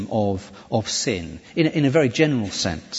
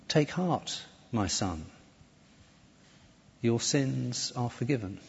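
soft, tender reassurance with long pauses; gentle, loving; 9 dB quieter, slower than usual. Acted. Jesus reassuring the man whose sins he forgives, gentle and loving. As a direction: say it softly, like comforting a son. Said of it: "the gentleness, the love in his voice".

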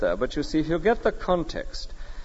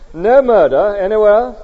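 emphatic proclaiming; 7 semitones higher, 9 dB louder than usual. Real, not acted. Preacher proclaiming a world without murder.

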